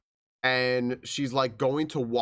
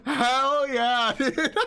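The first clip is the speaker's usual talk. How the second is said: excited cheer, pitched high and louder; 11 semitones higher, 4 dB louder, much slower than usual. Retold or imitated. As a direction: cheer her on with high, loud enthusiasm. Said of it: "I was just like".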